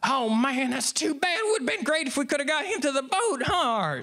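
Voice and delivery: very high put-on wife voice, rattled off; Linda. Wife consoles Art over the lost fish, high mock chatter. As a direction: imitate a chattering woman in high falsetto. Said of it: "And Linda goes".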